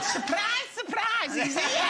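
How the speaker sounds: laughing surprise. Real, not acted. Host laughing, calling out.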